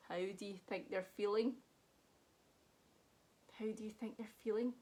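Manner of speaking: lively, sing-song picture book read; reading to children. A narrator's reading. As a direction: read it like a bedtime story for kids. Reads picture book aloud.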